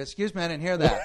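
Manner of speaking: loud, laughing challenge; confrontational call. Retold or imitated. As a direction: call after him, daring him to repeat. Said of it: "I go".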